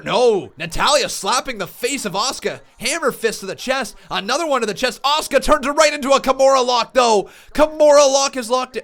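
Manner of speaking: high, loud play-by-play yelling; frantic wrestling commentator; excited commentary. Real, not acted. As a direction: call the action at full blast. Play-by-play of match.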